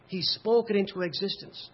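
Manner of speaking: usual speaking voice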